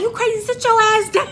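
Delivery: high, loud imitated order; alarmed scolding. Driver telling wild passenger to sit, alarmed shout. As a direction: scold her in a panic.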